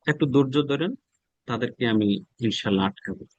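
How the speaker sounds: ordinary talk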